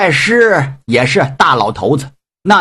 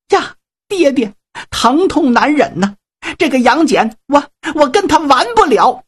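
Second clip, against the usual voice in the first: put-on much higher, tearful young woman's voice; crying daughter; 9 semitones higher than usual. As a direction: whimper it like a hurt, weeping daughter. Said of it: "女儿邓婵玉正哭呢".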